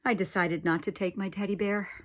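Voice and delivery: ordinary delivery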